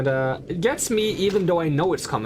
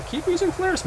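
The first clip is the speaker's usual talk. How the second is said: "using" said much higher and brighter; high-pitched. Shown as a demonstration. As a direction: squeak it up high. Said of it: "repeated with high voice".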